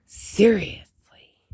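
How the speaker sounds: slow whispered seriously; whispered scold. Real, not acted. Vlogger whispering a mock scold at her dog.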